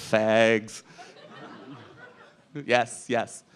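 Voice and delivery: ordinary delivery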